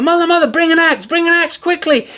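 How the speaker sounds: very high, loud, fast desperate call; calling down urgently. A narrator's reading. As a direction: yell down to mother in panic. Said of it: "he called down to his mother".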